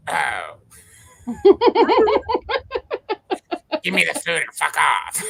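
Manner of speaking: grumpy cat voice. Pretend. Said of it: "Indy's voice when I make her, like, talk".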